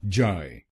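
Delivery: "जाय" said alone as "jai"; syllable demo. Shown as a demonstration. Teacher isolating syllable for students, clear. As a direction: give the syllable "जाय" on its own. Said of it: "दूसरे शब्दांश को बोलिए".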